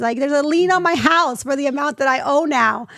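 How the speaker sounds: incredulous rant about the IRS; exasperated disbelief. Retold or imitated. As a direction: throw your hands up at the tax bill. Exasperated retelling of tax shock.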